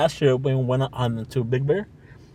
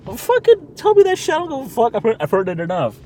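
loud, much higher, daring them; defiant shout; 10 semitones higher, 5 dB louder than usual. Real, not acted. Defiant host shouting at listeners to bring it on. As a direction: call it out loud and high.

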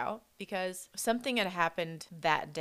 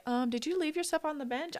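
higher, lightly hammed-up dude asking; mocking the dudes who doubted her at the gym, higher voice; 10 semitones higher than usual. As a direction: mimic a condescending gym guy, pitched up.

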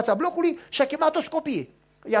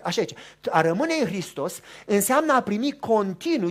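normal talking voice

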